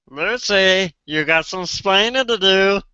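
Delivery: put-on playful Cuban-accented scolding; Ricky Ricardo impression. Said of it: "I can just do".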